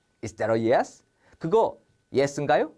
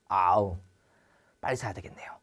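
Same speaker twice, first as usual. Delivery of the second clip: whispered aside; 8 semitones lower, faster than usual. Acted. Shopper muttering about the sale, whispered.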